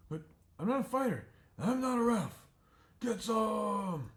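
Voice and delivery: high, loud announcer ref; referee voice. Acted. The porcupine ref asks the fighters, high and loud. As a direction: call out like a fussy little referee.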